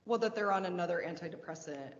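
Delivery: normal talking voice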